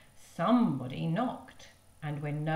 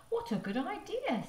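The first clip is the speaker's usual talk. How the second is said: mother's voice; 12 semitones higher than usual. Acted. Mother voiced in read story.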